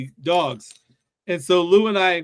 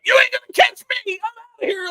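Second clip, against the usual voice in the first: high, fast squeaky puppy taunt; cheeky puppy voice; 4 dB louder, faster than usual. Pretend. Puppy escaping its cage at the hangar, high squeaky taunt. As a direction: squeal it like a runaway pup.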